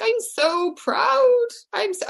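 high, drawn-out gush of pride; tearfully proud mother. Retold or imitated. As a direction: imitate a mum overcome with joy, stretching it out slowly. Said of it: "she was crying through joy".

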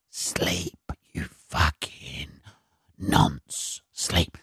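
slow, low ASMR whisper, mock-soothing; Gary. Mock geezer host lulling listeners to sleep, slow low whisper. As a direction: whisper the insults like a lullaby, very slowly. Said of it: "it ain't some geezer, uh, you know, saying".

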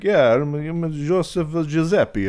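slow, high Italian immigrant voice; Italian accent. Retold or imitated. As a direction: drawl out the name like an Italian newcomer. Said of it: "an Italian guy would come in and be like".

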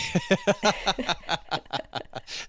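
high, long burst of laughter; big laugh. Real, not acted. Co-host tickled by the guest's phrase, long high laugh. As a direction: crack up laughing.

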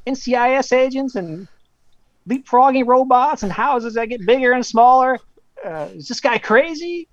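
high-pitched Southern caller rattling on; Southern accent. Retold or imitated. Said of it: "like, Southern accent".

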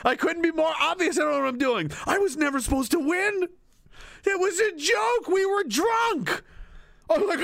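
high-pitched, mock-desperate protest; panicked reluctant leader. Lost leader insisting it was all a joke, mock panicked. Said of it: "lost in his own mind".

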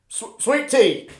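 slow, high, laughing through the order; giggling imitation of a fumbled order; laughing mimic. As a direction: stutter it out while cracking up.

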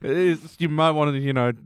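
fast, laughing through the words; amused, can't hold the laugh. Real, not acted. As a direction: giggle it out quickly. Laughing at the absurd scene.

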